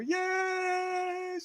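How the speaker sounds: very high shouted cheer; cheering crowd. Pretend. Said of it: "They'd bloody go".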